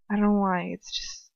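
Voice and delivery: ordinary delivery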